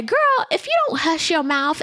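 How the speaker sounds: sassy retort. Retold or imitated. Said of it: "I want to gr-, say".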